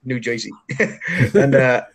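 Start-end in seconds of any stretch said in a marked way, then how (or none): 0.0-0.5 mock local New Jersey accent, JOISEY; exaggerated New Jersey accent. Shown as a demonstration. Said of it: "You said that with the accent".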